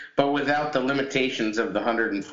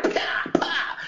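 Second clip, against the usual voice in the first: mock punch noises. Mimicking attacks online.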